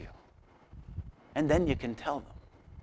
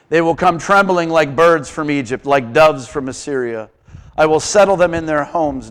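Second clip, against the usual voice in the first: louder proclaiming of the verse; loud scripture reading; 9 dB louder than usual. Acted. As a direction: declare God's words to the church. Reads scripture aloud loudly.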